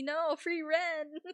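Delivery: high, slowly drawn out through a laugh. Shown as a demonstration. Streamer mishears Frieren as free rent, laughing. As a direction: savour free rent like a joke. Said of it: "In this economy".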